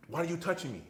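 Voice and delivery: retold confrontational yell, pitched well up; retold rising outburst. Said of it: "his voice kept going louder and louder".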